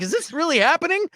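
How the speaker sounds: high-pitched mock disbelief; incredulous. Moviegoer recalling a bad casting moment, incredulous. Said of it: "I couldn't believe it".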